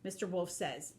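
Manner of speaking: plain reading voice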